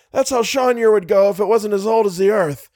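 high-pitched urgent explanation; excited cry. A narrator's reading. Driver explains the turtle's fate, crying out. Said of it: "cried Little".

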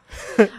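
breathy silent laugh; unvoiced laugh. Real, not acted. Laughs before story. (无声气音笑)

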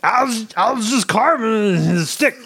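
high, strained put-on comic voice; Bobcat Goldthwait impression. Dwarf defending his whittling to Droth, high strained comic voice. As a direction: squeeze it up into a twitchy stand-up whine. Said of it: "This is Bobcat Goldthwait".

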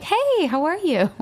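high, slow, bright-eyed mock hello; fake cheery greeting. Wife mocking the cheerful greeting she can't give him, high and slow. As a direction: chirp it as if nothing's wrong. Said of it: "I'm not there like".

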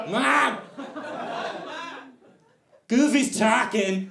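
put-on tattling kid. Little kid tattling to his mom about Goofy, high excited squeal. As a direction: squeal it like a snitching child. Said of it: "he goes".

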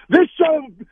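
high, loud cut-off curse; retold angry outburst. Player still raging at midfield despite the official, high angry sputter. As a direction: sputter the curse in mock fury. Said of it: "I'm like".

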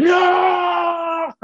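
long, high-pitched shouted wail; [name] screaming. Acted. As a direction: scream it out in mock anguish, drawn out. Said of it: "just screaming".

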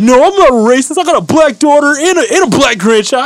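high, loud mock protest of innocence; indignant protest. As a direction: imitate an offended man defending himself. Acting out accused neighbor's protest.

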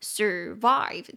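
survive stressed on the second syllable, sur-VIVE. Shown as a demonstration. Teacher stresses the second syllable of survive. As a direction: let survive land hard on its second syllable. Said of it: "中音节在第二音节".

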